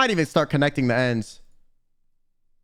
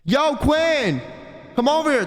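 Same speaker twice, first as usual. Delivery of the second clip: mock yell; 9 semitones higher, slower than usual. Lawyer calling Quinn across the room, mock yell. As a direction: call him over like a lawyer yelling. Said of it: "a lawyer screams across the fucking room".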